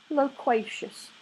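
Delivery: slow, empty and toneless; hollow voice. A narrator's reading. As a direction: speak from a trance, hollowed out. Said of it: "said Mary Hodges in a hollow voice".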